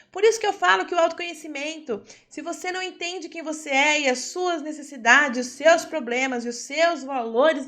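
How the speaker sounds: loud, driven lesson delivery, hammering each point; impassioned preaching. Acted. Teacher preaching loudly to her class about self-knowledge. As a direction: preach it hard to the room.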